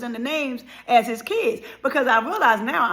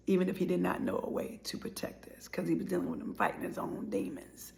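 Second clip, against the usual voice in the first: softer, unvoiced, choked whisper; tearful whisper; 4 semitones lower, 10 dB quieter than usual. Real, not acted. Grieving her late father, a tearful whisper. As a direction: whisper through tears.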